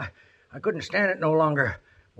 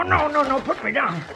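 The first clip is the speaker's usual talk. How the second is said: pained protest; 11 semitones higher than usual. Acted. Injured man protesting pain.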